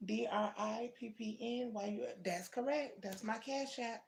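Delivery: ordinary talk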